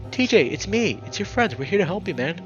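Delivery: high, loud plea; urgent pleading. Acted. Leo pleading with crazed lynx, urgent loud appeal. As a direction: plead to get through to him.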